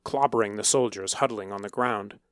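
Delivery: ordinary delivery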